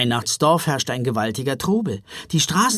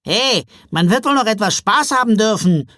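high, loud elf protest; complaining; 7 semitones higher, 6 dB louder than usual. A narrator's reading. Christmas elf wanting some fun, complaining loudly. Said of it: "beschwerte sich ein Weihnachtswichtel".

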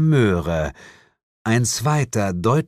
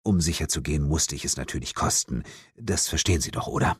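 leises Beiseite; 6 semitones lower, 6 dB quieter, much faster than usual. A narrator's reading. Augenzwinkerndes Beiseite zum Leser.

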